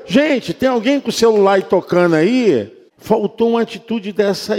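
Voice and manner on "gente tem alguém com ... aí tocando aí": calling out. Retold or imitated. Pastor calling out to congregation.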